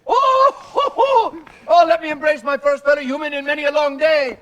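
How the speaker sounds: high, loud, fast mad rapture; manic glee. Acted. Blocking the rival's way, manic and high. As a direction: gush over him like a lunatic. Mad traveller greets the stranger.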